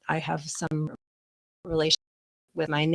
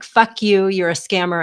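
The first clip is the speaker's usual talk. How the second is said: louder, swearing at the scammer; retold angry outburst; 3 semitones higher, 8 dB louder than usual. Caller confronting the scammer, in an angry retold outburst. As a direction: tell the scammer off hard. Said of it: "what came out of my mouth, pardon my language".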